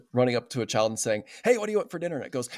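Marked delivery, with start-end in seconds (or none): 1.4-2.2 pushy parent question. Retold or imitated. Parent rushing a child.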